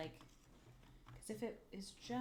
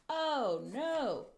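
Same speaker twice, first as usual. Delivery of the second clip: dismayed cry; 6 semitones higher, 8 dB louder than usual. Real, not acted. Clock turns out upside down.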